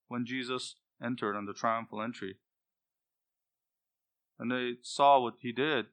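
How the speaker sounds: ordinary talk, nothing marked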